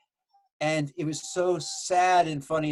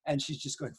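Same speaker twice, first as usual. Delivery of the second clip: ordinary talk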